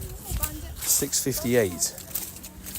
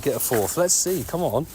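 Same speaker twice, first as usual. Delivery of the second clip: usual speaking voice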